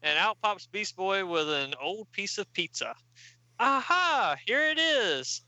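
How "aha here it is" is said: high, excited cry of discovery; acted triumph. Beast Boy finds oil, acted triumphant exclamation. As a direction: ring it out like a proud find.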